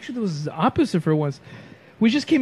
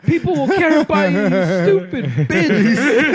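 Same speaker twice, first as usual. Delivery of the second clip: loud, slowed-down mock scolding, laugh creeping in; hammed-up tough-love shout; retold exasperated consoling; 6 semitones higher, 5 dB louder, slower than usual. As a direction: imitate a friend yelling someone out of despair. Imitates friend talking woman down.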